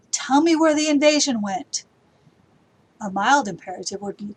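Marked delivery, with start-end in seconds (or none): 0.1-1.9 much higher and louder, demanding; forceful command. Shown as a demonstration. Teacher demos a strong imperative, loud and commanding. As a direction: order them to tell you. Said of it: "An imperative would be".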